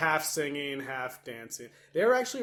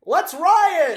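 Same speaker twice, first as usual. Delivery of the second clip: shouted rallying cry; 12 semitones higher, 9 dB louder than usual. Retold or imitated. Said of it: "the mice are immediately like".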